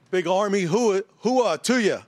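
booming army hoo-ah shout; loud gung-ho soldier. Real, not acted. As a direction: belt it out like a proud soldier. Soldier greets host with army cheer.

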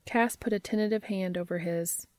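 ordinary delivery

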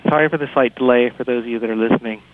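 ordinary delivery

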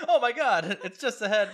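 real giggling shock; laughing. Real, not acted. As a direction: burst out laughing mid-line. Laughing at Hud's head.

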